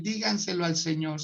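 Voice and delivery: normal talking voice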